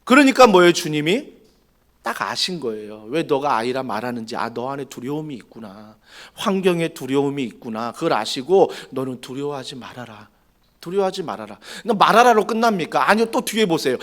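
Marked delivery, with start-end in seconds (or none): 4.4-11.6 soft, hushed reassurance; gentle comfort. Acted. God gently calming a fearful Jeremiah, softly. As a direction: soothe a frightened child.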